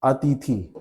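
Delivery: अतिथि ending in long ई; long final vowel. Shown as a demonstration. Teacher answering what people will say, mispronunciation demo. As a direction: stretch the last vowel of अतिथि to ee. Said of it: "क्या बोलेंगे?".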